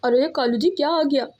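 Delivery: ordinary delivery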